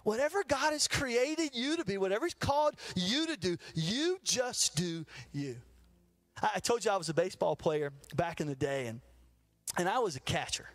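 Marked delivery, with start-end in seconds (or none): 3.7-5.6 slow emphatic. Acted.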